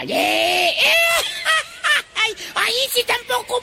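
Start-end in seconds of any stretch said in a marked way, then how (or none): 0.8-2.1 put-on high teasing childish laugh; gloating laugh. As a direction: laugh at the loser. Laughs at shadow's failure.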